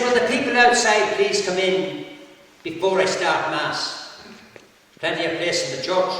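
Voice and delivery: calling out. Acted. Priest asking people outside to come in, calling out.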